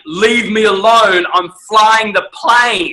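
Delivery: hammed-up grumpy captain; mock annoyed captain. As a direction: bark it like a fed-up pilot. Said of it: "what he's saying in his tonality".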